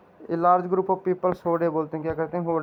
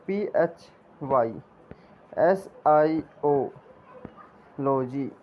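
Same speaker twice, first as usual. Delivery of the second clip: spelled out letter by letter; 3 semitones lower than usual. Shown as a demonstration. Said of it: "इस प्रकार से भी आप लोग याद कर सकते हो".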